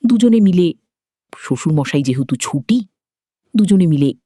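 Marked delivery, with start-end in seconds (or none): none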